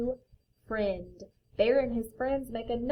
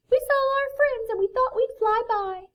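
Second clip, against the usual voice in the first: high, loud, level bird chirp; 14 semitones higher, 6 dB louder than usual. A narrator's reading. Raven and Wren dropping by their friends, high bird voice. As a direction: pipe it like a small bird.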